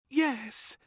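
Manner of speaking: high, loud reply; a high, loud yes; calling back. A narrator's reading. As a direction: call back across the field. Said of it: "called back the General".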